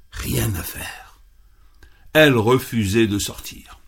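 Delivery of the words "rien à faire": hushed whisper. A narrator's reading. Narrator stresses futility.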